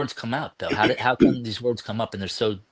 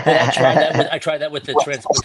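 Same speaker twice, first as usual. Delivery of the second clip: laughing; 5 semitones higher, 7 dB louder than usual. Real, not acted. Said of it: "laughs while answering".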